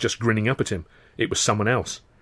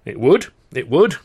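hurried concession; 4 semitones higher, 5 dB louder than usual. A narrator's reading. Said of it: "Chaplin conceded hurriedly".

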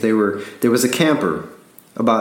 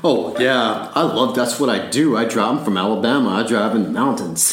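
cocky. Retold or imitated. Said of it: "my arrogant self said".

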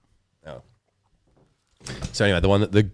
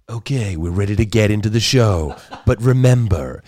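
slow, drawn-out host voice; imitating podcast host; 5 dB louder, slower than usual. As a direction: copy the creepy narrator, slowly. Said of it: "her guy goes".

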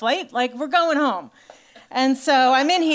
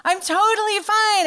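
much higher and louder, a strained squeak of denial; insisting I'm fine; 9 semitones higher, 4 dB louder, slower than usual. Retold or imitated. To her husband after passing out, high strained insisting. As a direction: squeal it out to prove you're okay. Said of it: "I'm just like".

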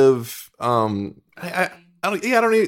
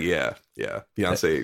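deadpan; 8 semitones lower, 8 dB quieter, much slower than usual. Retold or imitated. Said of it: "And I was like".

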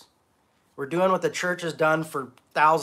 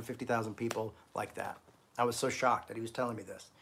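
ordinary delivery

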